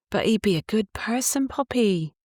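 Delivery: put-on a touch of Cornish accent breaking through; slipping into Cornish. A narrator's reading. Missus Trevethan to Poppy, a hint of Cornish accent. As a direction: let the Cornish accent slip back in. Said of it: "her accent reverting for a moment".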